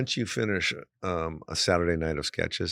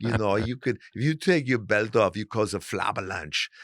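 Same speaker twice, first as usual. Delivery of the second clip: Hans bodybuilder voice; 3 semitones higher, 5 dB louder than usual. Retold or imitated. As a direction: sneer in a pumped-up Austrian accent. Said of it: "we're talking like Arnold Schwarzenegger".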